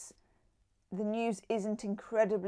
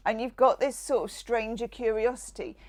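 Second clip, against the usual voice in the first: usual speaking voice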